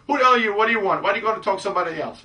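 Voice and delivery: high, loud, rattling fast at the end; bitchy girl. Retold or imitated. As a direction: play the rude girl, high and loud, rushing the last line. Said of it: "if she's just talking at you".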